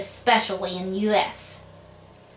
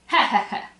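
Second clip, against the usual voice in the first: mock laugh; 2 semitones higher, faster than usual. Mock laugh after joke.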